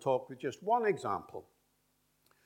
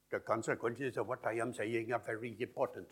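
syllable-timed Indian English, every syllable evenly weighted; Indian English rhythm. Shown as a demonstration. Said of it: "a lot in Indian English".

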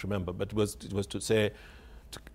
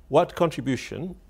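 raised, pointed questioning of a journalist; imitating a journalist's loaded question; journalist's challenging tone; 5 semitones higher, 4 dB louder than usual. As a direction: put it to the writer like a challenge. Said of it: "I say it in that tone of voice".

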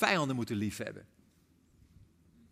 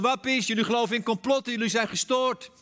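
raised, higher voice of accusation; mock shouting; 6 semitones higher, 4 dB louder than usual. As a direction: imitate the other camp yelling back. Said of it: "Die lopen ook te schreeuwen".

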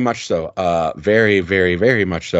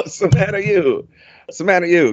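mock Italian chant, stretched; Italian accent; 7 semitones higher than usual. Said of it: "Italian accent".

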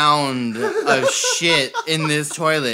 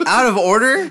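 loud, high, rising question; incredulous yelp of a question; 7 semitones higher, 6 dB louder than usual. Retold or imitated. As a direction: blurt it in outraged disbelief. Incredulous at the sign.

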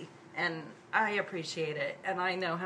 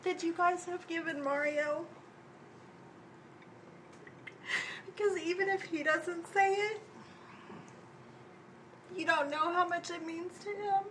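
higher, halting, close to tears; emotional; 9 semitones higher, 6 dB quieter than usual. Real, not acted. Thanking the group for supporting Mario, voice welling up. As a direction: push through the lump in the throat. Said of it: "I'm gonna get emotional".

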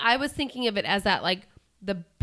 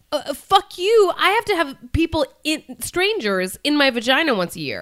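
loud, high exasperated rant; indignant outburst; 6 semitones higher, 5 dB louder than usual. Retold or imitated. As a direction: rant it out, fed up. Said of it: "And I'm like".

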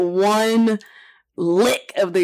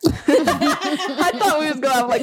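laughing; 10 semitones higher, slower than usual. Real, not acted. Laughing, slow.

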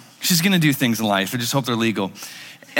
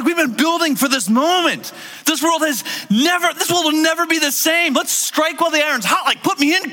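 higher, pumped-up voice of an impatient disciple; eager disciples; 10 semitones higher than usual. Retold or imitated. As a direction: sell it like a player begging the coach to go in. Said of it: "We're like".

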